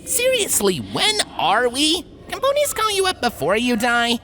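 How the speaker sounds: lightly voiced scratchy filly ghost; a touch of scratch in a teasing spirit. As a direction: tease her in a scratchy voice. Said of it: "said a scratchy voice".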